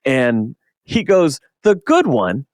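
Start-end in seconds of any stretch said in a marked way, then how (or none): 1.6-2.4 retold mock offence. Artist's mock-offended retort, retold.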